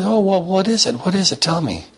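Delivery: high-pitched, insistent grandmother; eager curiosity. Acted. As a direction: press eagerly to be let in on the secret. Grandmother begs to hear his secret.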